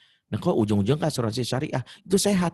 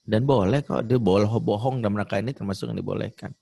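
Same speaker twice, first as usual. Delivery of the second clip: ordinary talk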